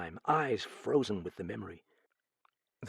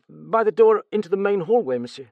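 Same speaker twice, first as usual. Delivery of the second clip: stammering, higher, louder frightened servant; nervous stammer; 10 semitones higher, 8 dB louder than usual. Acted. Steward questioned about the murder, nervous stammer. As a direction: stumble over the words in fright.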